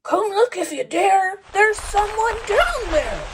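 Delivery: high, fast eager call; excited animal voice. Acted. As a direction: voice a badger, quick and high. Badger calls the others to look.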